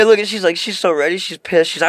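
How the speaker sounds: ordinary delivery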